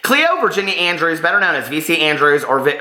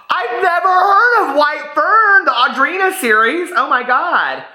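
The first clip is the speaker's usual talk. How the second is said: real shock; 12 semitones higher, slower than usual. Real, not acted. Learning of a book she never heard of, astonished cry. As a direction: cry out in disbelief.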